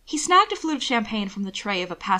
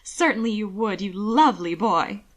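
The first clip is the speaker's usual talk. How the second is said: purred. Acted. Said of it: "she purred".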